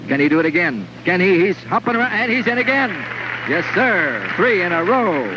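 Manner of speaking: high, thrilled strike call; excited play-by-play. Real, not acted. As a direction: shout the strike with delight.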